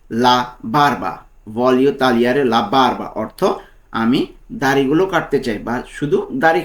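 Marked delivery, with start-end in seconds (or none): 0.1-3.1 slow, drawn-out "voglio tagliare la barba"; slow model phrase. Shown as a demonstration. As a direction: say it slowly for students to repeat. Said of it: "তো এর Italian কী হবে?".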